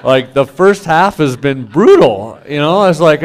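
worn-out pastor venting about the brutal years; exasperated. Retold or imitated. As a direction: pour out the exhaustion, pitched high and loud. Exasperated rant about brutal life.

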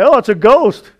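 retold scared exclamation; mock fright. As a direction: imitate a spooked reaction, lightly hammed-up. Mock re-enacting the frightened disciples.

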